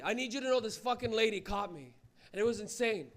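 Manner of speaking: ordinary talk, nothing marked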